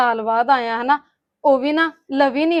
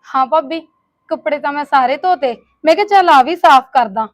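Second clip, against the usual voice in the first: louder, eager reply; loud answer; 9 dB louder than usual. Acted. Sister-in-law answers loudly to bhabhi. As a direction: call out over the chores.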